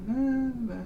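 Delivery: high, lilting little sung notes; sing-songy woman. Retold or imitated. Recounting a voice heard in the night, sing-songy woman. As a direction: imitate an eerie sing-song woman's voice. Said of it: "I hear this sing-songy woman's voice".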